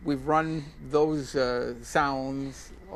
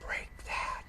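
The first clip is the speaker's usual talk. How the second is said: hushed, voiceless whisper; breathy imitation of a whispered spirit voice; imitated ghostly whisper; 11 dB quieter than usual. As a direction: whisper it like a faint voice on a tape. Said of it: "you heard a voice say".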